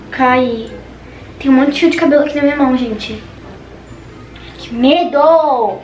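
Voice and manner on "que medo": very slow, drawn-out que medo; frightened wail. Real, not acted. Girl holding fallen hair, a slow scared wail. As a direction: stretch the fear out long. Hair coming out in her hand.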